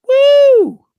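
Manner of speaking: loud, very high "woo"; celebratory whoop. Real, not acted. Fan cheering the team's win streak, celebratory whoop.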